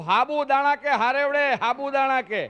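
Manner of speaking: loud and slow, hammering sabudana as if to someone who doesn't understand. Retold or imitated. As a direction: spell out sabudana slowly and loudly. Repeats louder to a confused listener.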